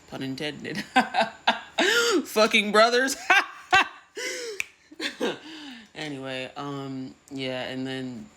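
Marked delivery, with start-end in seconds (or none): 0.9-2.2 real high burst of laughter; high laughing fit. Real, not acted. Speaker laughing at their own pun, high bursting laugh. 2.2-3.1 louder, higher, through laughter; laughing repeat. Real, not acted. Speaker repeating the pun to the audience, loud and laughing. 3.2-4.6 real high burst of laughter; high laughing fit. Real, not acted. Speaker laughing at their own pun, high bursting laugh.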